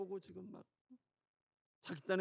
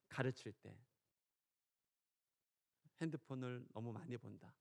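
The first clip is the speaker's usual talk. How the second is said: usual speaking voice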